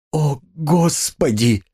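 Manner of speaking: high, slow cry of dismay; anguished outburst. A narrator's reading. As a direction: let it escape as a drawn-out plea. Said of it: "вырвалось у Сони". (вырвавшийся возглас отчаяния)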